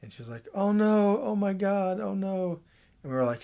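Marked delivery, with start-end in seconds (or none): 0.5-2.6 high, tearful "oh no"s; upset sister, crying. Retold or imitated. Sister hearing the pregnancy news, high and tearful. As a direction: voice the shocked sister near tears. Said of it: "she started crying".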